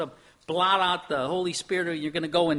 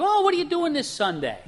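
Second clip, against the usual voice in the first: chatty acquaintance; 11 semitones higher than usual. Retold or imitated. Acted casual question from acquaintance.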